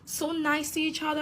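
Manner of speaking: high sing-song sarcastic gush; mock naive gushing. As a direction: parody someone claiming all is rosy. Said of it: "I'm not gonna act like".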